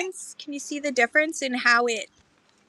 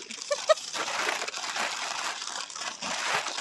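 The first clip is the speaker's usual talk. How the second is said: breathy laugh; 6 dB quieter than usual. Real, not acted. Laughs at her own joke.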